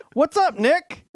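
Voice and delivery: high, bright mock-cheerful greeting; cheery dad. Dad greeting his daughter's drunk friend, high and cheerful. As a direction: sing it out like an amused dad.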